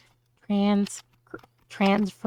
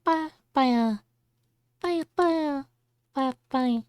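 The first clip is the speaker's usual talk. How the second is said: higher creature babble; Kirby playing games; 5 semitones higher, 3 dB louder, slower than usual. Imagining Kirby playing a game, higher babble. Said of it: "And he's just like".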